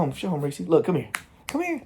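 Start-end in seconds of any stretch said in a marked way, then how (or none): 1.5-1.8 high-pitched dog call. Real, not acted. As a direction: jump way up into a squeaky sweet call. Coaxing dog to come over.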